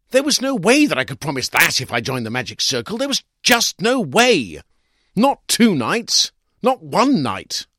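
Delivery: high, loud, slowing hard on each phrase; outraged insistence. A narrator's reading. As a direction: pound it home, indignant. Narrator building to outraged insistence.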